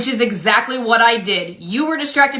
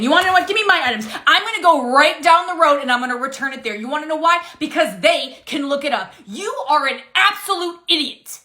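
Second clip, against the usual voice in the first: higher, heated and rising with anger; angry outburst; 5 semitones higher than usual. Acted. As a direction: let her boil over and storm off. Angry customer storms off.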